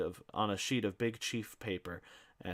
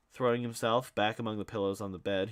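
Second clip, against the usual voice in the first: usual speaking voice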